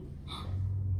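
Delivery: quick startled breathless gasp; sharp gasp. Real, not acted. Blending eyeshadow too high, she gasps sharply in alarm. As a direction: catch your breath at a near mistake.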